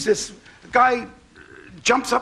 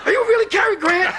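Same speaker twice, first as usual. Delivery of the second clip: excited; 9 semitones higher than usual. Retold or imitated. Starstruck asking Cary Grant.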